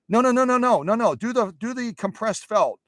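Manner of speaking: high-pitched, acted objection; insistent viewer. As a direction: cut in as a pleading fan.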